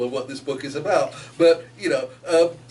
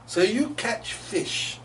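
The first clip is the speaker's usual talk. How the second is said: slow, lazy drawl; drawling heron; 5 semitones higher, 4 dB quieter, slower than usual. Acted. Heron sizing up the otter, slow drawl. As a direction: drawl it, unimpressed. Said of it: "the heron drawls".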